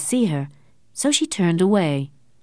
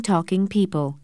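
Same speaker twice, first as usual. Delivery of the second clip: usual speaking voice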